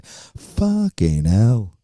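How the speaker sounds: slow, drawn-out British "Fucking hell"; lightly done British accent of a mate cursing; stretched mock British curse; Danny Headcase impression. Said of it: "That's my Danny Headcase imitation".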